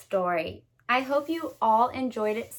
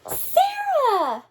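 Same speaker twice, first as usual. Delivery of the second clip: high, startled exclaim of Sarah; gasped cry of a name. A narrator's reading. Narrator crying out as Truman is spotted. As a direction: call her name out in shock.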